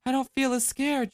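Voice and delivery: put-on girl asking Ma Marie, softly; little girl, softly. A narrator's reading. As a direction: ask softly like a small girl. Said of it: "she told him".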